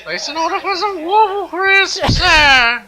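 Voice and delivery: high-pitched mock New York British accent. Ordering cereal by phone, silly New York British accent. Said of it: "British accent".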